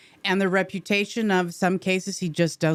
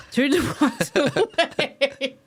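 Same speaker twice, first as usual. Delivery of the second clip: higher, slowed by real laughter; laughing; 5 semitones higher, slower than usual. Real, not acted. Actress tickled by Eastwood's brief verdict, laughing. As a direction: laugh through the words. Laughing at her own story.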